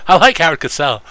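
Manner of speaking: high, loud announcer read of like. Shown as a demonstration. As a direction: belt it like a sportscaster.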